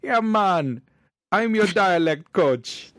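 high, loud mock Jamaican patter, slowing on coach; jokey fake Jamaican, the guy bluffing as coach; bad Jamaican accent. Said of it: "I say".

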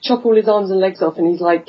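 ordinary delivery

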